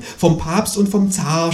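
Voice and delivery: slow, high groaning ghost. A narrator's reading. The ghost complaining to the kangaroo, slow mock groan. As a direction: moan it out slowly. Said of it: "stöhnt das Gespenst".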